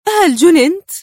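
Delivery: high, loud incredulous cry; utter astonishment. A narrator's reading. Woman hearing he will fly to Tel Aviv, astounded. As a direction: burst out in disbelief. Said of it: "في دهشة عارمة قبل أن تهتف".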